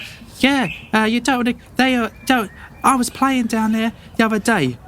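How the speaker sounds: put-on very high excited kid voice; a boy blurting out a secret; eager boy. Boy eagerly telling strangers about the bandits, very high. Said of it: "He's like".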